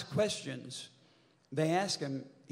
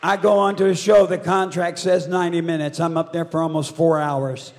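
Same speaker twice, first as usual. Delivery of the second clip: projected hard, far above talking level; loud boast; 14 dB louder than usual. Real, not acted. Performer defending his long stage time, loud and defiant. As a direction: belt it out defiantly to the crowd. Boasts loudly about show length.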